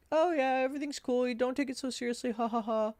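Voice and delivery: high-pitched mock-breezy buddy voice; playing it off. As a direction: imitate a fake friend laughing it away. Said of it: "Like, playing it off".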